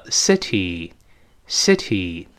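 slow "city", lax first vowel and final ee; correct pronunciation. Shown as a demonstration. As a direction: model "city" as "sit-ee" with clear T. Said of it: "不对，叫city".

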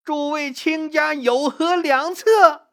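high, drawn-out imperious call; crying out. A narrator's reading. Empress dowager summoning counsel from her ministers, crying out. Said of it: "就大喊起来".

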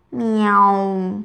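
long, unexcited meow; answering cat meow. A narrator's reading. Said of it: "威尔伯应了一声".

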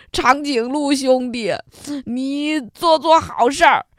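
hammed-up sobbing wolf, gulping between phrases; crying while talking. A narrator's reading. Old wolf begging the giraffe to pull his teeth, crying. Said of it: "他一边哭一边说".

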